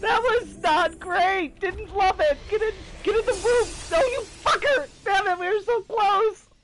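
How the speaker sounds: high, loud furious cries at the game; frustrated yelling. Real, not acted. Gamer yelling at enemies while losing the fight. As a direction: shout at the game as it goes wrong.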